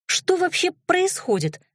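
very high, thin squeak; small high-pitched protest; squeaked question. A narrator's reading. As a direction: squeak it out in bewilderment. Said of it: "пискнула я".

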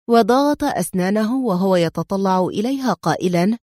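ordinary delivery, nothing marked

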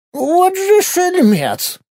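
put-on high, slow old woman's voice; old great-grandmother. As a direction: say it like a scolding old granny. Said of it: "Как бы сказала моя прабабка". (голос прабабки)

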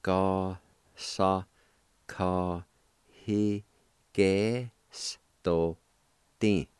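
"Ka, sa, ka, hi, ge, s, to, ti" each syllable set apart. Shown as a demonstration. Teacher sounding out the syllables for students, one at a time. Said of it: "it's pronounced like this".

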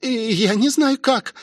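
put-on high, helpless girl's voice; anxious high girlish reply; panicky. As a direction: protest helplessly in a high pitch. Rosie panics, does not know how.

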